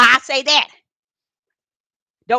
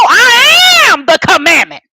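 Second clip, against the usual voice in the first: slow, loud proclaiming shout; preaching shout; 3 semitones higher, 5 dB louder than usual. Real, not acted. Preacher shouting the scripture to his live-stream church. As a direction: thunder it out from the pulpit.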